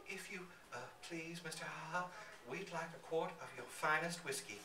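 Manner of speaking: barely find your voice; frightened old woman asking the giant, softly; whispery voice. Acted. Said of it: "half finds her voice, a whispery voice at best".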